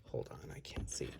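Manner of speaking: hushed, peering whisper. Real, not acted. Squinting at tiny box art in a hushed whisper. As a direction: breathe it as you lean in close.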